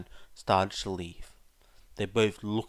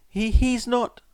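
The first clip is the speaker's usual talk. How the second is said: flustered denial; 14 semitones higher, 9 dB louder than usual. Acted. Embarrassed boy denies.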